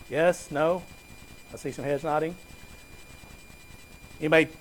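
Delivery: normal talking voice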